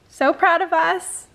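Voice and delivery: high, loud and proud; proud cheer. Real, not acted. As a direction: cheer for the shared win. Celebrating the completed goals.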